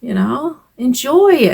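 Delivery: a lightly voiced upbeat dad urging her to enjoy the rain; imitating dad. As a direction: son recalling dad cheerily coaxing grandma. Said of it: "he would say to her".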